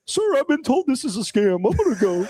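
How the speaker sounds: high, overly polite little voice; prissy high-pitched politeness; polite Cody. Retold or imitated. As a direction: mimic a meek, polite guy hanging up. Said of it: "Cody's, like, real nice".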